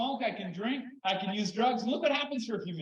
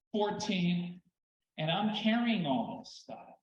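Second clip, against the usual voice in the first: ordinary talk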